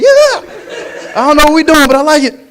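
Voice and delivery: squealing way up high and loud, pure delight; a dog spinning in circles of joy; excited dog. Acted. Dog running circles beside its owner, squealing happily. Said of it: "my dog get excited, he start running circles. Like".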